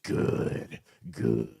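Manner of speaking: mildly voiced, hushed gloating over a plan; a hint of a scheming adviser pleased with himself; smug consultant. Consultant gloats as the candidate curses, lightly hushed. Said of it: "rubbing their hands together like he's just acing this".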